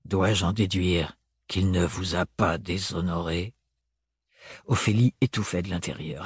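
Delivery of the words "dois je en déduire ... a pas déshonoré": low, slow, formal male voice; restrained, deliberate low delivery; Thorn, stiff interrogation. A narrator's reading. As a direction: spell out the question coldly and slowly.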